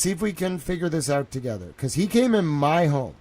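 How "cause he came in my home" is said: normal talking voice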